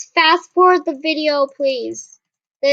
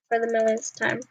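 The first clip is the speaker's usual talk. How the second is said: ordinary talk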